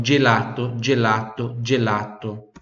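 "gelato" with a soft J sound, repeated. Shown as a demonstration. Teacher drills the soft G in "gelato". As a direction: say "gelato" with J like jelly. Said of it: "G tem som de D".

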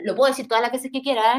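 ordinary talk

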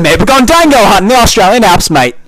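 loud, high put-on Aussie; Australian accent. Friend defending his date bit, loud Australian accent. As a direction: belt it out in a broad Australian accent. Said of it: "and I went Australian".

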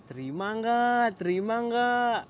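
loud, slow chanted "accept or not?"; friend's yelled question. Retold or imitated. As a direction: draw it out, shouting. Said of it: "langsung teriak".

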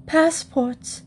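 high, startled cry; alarmed exclamation. A narrator's reading. Missus Bird realising there is a passport problem, alarmed. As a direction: exclaim it as a sudden dreadful realisation. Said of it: "exclaimed Missus Bird".

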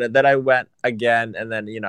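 normal talking voice

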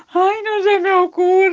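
high, loud, slow wail; hammed-up despairing outburst; very sad piglet. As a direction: howl it like a helpless little creature. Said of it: "estoy muy triste".

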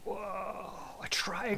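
hushed, whispered mock amazement; mock awe. Host reacting to a drafting triangle, in whispered mock awe. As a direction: whisper it as if awestruck.

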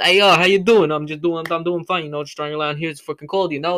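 loud, high, hammed-up New York guy shooting the breeze; New York accent. Podcaster acting out New Yorkers small-talking in the cold, mock New York accent. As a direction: play a chatty New Yorker, big and friendly. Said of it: "If you talk like that here".

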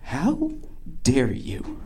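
quiet mock outrage, broken by pauses; soft, halting, offended disbelief; hushed indignation. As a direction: imitate someone too appalled to speak up. Acting offended woman's hushed outrage.

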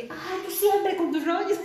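mildly exasperated mock complaint, drawn out slowly; imitated annoyance. Imitating a relative fed up with the speaker's causes, mildly annoyed. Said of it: "A veces sí se enoja".

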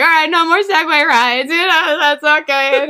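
retold anxious crowd control; cop intervening. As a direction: imitate an officer hastily shutting the fun down. Cop calling off the rides.

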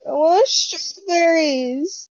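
put-on high, slow childish whine; teary whine. Teen begging her mom for strawberries, whiny. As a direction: whimper it like a kid near tears. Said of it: "And I'm like".

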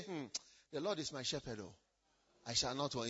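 low, hushed, reverent recitation; end-of-life reflection. Acted. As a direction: murmur it low as if looking back on life. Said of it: "when you are at the end of your life, you will say".

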